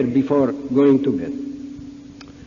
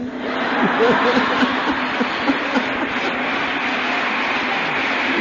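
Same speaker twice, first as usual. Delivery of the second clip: real hearty laugh; 7 semitones higher than usual. Real, not acted. After the hostess's quip, real laughter. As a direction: open breathy laughter.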